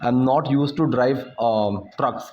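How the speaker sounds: slow, deliberate example sentence; slow teaching demo. Shown as a demonstration. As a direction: lay each word out slowly. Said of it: "ठीक है?". (धीरे-धीरे समझाते हुए)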